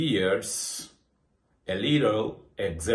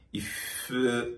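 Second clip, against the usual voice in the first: ordinary delivery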